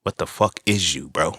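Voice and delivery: low, hushed disbelief; softly muttered private challenge; inner thought. Retold or imitated. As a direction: drop it down as if only thinking it. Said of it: "But in my head".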